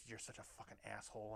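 soft furious hissed insult; quiet scream. Retold or imitated. Ex-wife hissing insults at her husband, quiet scream. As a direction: scream it without any volume. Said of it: "just quiet scream".

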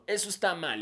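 judgy commenter. Retold or imitated. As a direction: lecture them like a judge.

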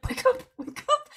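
high, urgent mock plea; high frantic hiss to a sleeping husband; frantic wake-up. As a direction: shake him awake in a panic. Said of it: "I am beating Tanner".

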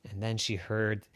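usual speaking voice

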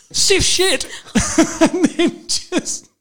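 loud, high laughter breaking through the words; laughing hard. Real, not acted. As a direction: crack up helplessly at the punchline. Punchline dissolving into laughter.

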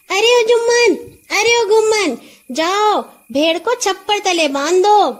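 high, loud call to the servants. A narrator's reading. Nora calls servants to tie up the horse, loud call. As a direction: call across the yard to the boys. Calling servants across the yard.

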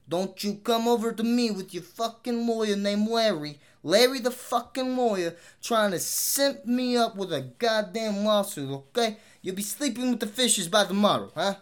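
mock New York tough guy warning off a lawyer; thick New York accent. Warning listeners off lawsuits, in a hammed-up New York mobster accent. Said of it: "how horribly thick I tried that out".

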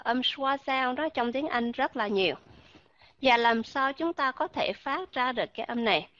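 normal talking voice

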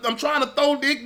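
ordinary delivery

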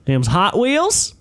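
high, slow, loud incredulous question; confrontational coach. Retold or imitated. Coach questions a student about toy cars, confrontational. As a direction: draw it out as a pointed, rising question. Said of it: "starts laying into this kid".

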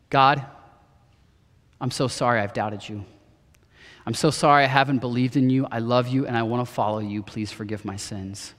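low, soft, halting apology to God; understated, hushed plea for forgiveness; retold tearful prayer. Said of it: "my dad starts crying, and he says".

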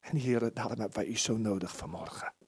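soft, low closing prayer; hushed church prayer. Acted. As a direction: pray quietly to God.

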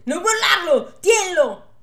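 high, loud frantic yell. Acted. Farmhand yelling at the priest not to let go, loud. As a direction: shout him to hold on. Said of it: "urla il bracciante".

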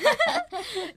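high laughing exclamation. Real, not acted.

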